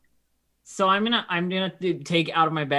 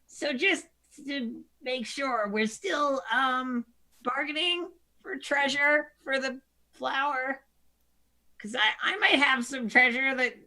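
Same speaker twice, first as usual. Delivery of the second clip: timid kobold voice; 10 semitones higher than usual. Acted. Player voicing timid kobold character.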